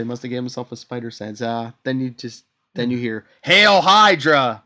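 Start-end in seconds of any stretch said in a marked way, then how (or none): 3.4-4.6 loud, slow, drawn-out chant; slow booming Hail Hydra; shouted battle cry. Retold or imitated. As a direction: bellow it like a fanatic soldier.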